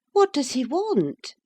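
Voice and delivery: high, lightly voiced young mistress asking; high young woman's voice, curious; Catherine, lady of the house.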